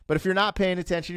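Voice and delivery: usual speaking voice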